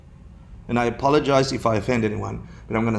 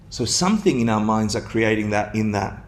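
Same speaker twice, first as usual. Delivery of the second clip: ordinary talk, nothing marked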